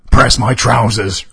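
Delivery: loud shouted demand. Acted. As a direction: bark it out as a drunk order. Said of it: "shouted Robert".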